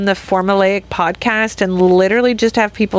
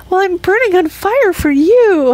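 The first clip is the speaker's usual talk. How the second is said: exasperated; 9 semitones higher, 4 dB louder than usual. Retold or imitated. Retorting own younger self.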